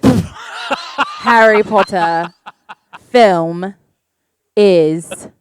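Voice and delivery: put-on deliberate, emphatic British accent; hammed-up British film-fan voice. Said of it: "answer it in a British accent".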